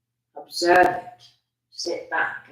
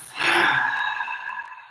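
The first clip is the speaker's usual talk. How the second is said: long, audible breathy sigh; exhale through mouth. Shown as a demonstration. Meditation guide showing the exhale, long open-mouthed sigh. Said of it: "exhaling out of the mouth".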